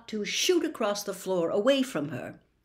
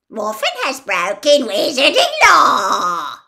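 pompous sing-song parody, loud and higher; mocking imitation; 7 semitones higher, 14 dB louder than usual. A narrator's reading. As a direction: ape the official's pompous tone. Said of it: "imitated Ogden's voice, making it pompous and sing-song".

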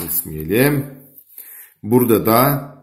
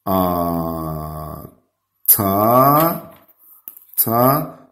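dictating syllables; much slower than usual. Shown as a demonstration. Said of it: "Hadi yazalım".

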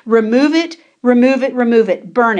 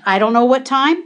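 usual speaking voice

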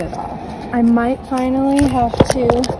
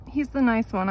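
ordinary delivery

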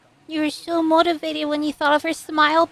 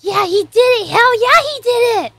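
loud, higher whooping celebration; excited cheer; 7 semitones higher, 7 dB louder, faster than usual. Real, not acted. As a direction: cheer like your team just won. Celebrating the character's success.

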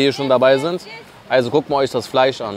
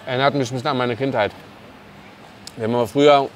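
words muffled by chewing; talking while chewing; faster than usual. Real, not acted. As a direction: mouth partly full of food. Seen 1: Bites döner just before, then talks with a closed, working mouth.

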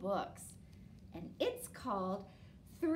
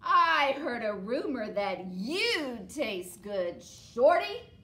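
troll taunt; 9 dB louder, slower than usual. Acted.

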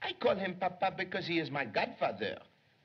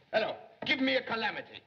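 flustered; 7 semitones higher, faster than usual. Acted. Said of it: "No, no, no, no, I mean".

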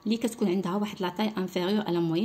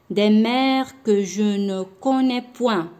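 slow, louder recital of the line; a short pause, then declaim it slowly; poem read with pauses; 2 semitones higher, 7 dB louder, much slower than usual. Shown as a demonstration. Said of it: "نوقف غير شوية". (إلقاء بطيء وبصوت مرتفع بعد وقفة قصيرة)

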